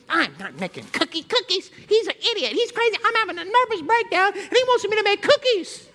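high, huffy mock tirade; indignant rant. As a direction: vent like an offended church lady. Woman ranting after storming out.

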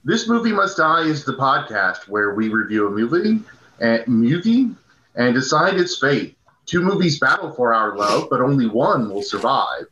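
normal talking voice

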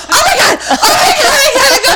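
high-pitched, squealing mock desperation; desperate panic. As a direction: act out frantic urgency, half laughing. Reenacts desperate plea to son.

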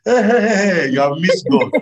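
slow, laughing pronouncement; amused, high-pitched teasing. Real, not acted. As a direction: say it through laughter, drawn out. Laughs while mocking the call taker.

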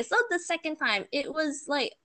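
usual speaking voice

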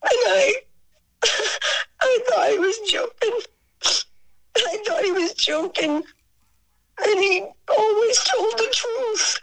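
high voice on the edge of sobbing; real choked-up, high-pitched reminiscence; tearful. Real, not acted. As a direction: hold back tears as you remember him. Overcome remembering Frank and his honesty.

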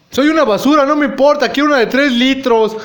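high, loud brazen declaration; shameless confession. Acted. Nacho admits he only wants soda, high and loud. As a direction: own it loudly, no shame.

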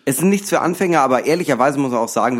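usual speaking voice

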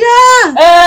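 high mock squeal of delight; imitated "Ah!" of joy, pitched high; delighted scream. Friends finding the packed dance floor, delighted squeal. Acting out crowd's delighted reaction.

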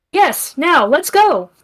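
a loud excited call to run; urgent exclaim. Acted. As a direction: hurry them out of the tent. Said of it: "Finnegan exclaimed".

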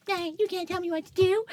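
high-pitched mock tantrum; mock defiance. Tiny fighter mouthing off at a huge friend, mock defiant. Drunk self defying her friend.

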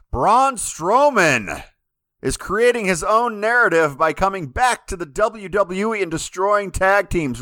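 high, drawn-out hype announcer; slow, high-pitched build-up to a big topic. Real, not acted. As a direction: stretch the name out big and high like a ring intro. Introduces next topic with big hype.

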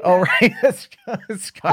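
high, slow, giggling correction; laughing. Real, not acted. Caught confusing Irish and Scottish, laughing high. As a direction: laugh at your own mistake.

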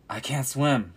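a touch of panic, cry it out; frightened cry. A narrator's reading. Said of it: "cried another".

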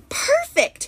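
high, ringing cry of triumph; a witch thrilled with her spell; booming delight. A narrator's reading. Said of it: "her voice boomed".

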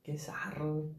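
soft breathy whisper aside. Real, not acted. Friend whispering an aside to the podcast hosts. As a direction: whisper it under your breath.

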